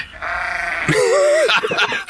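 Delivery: big high laugh at his own punchline; high wheezing laugh. Real, not acted. Farmer laughs at own joke.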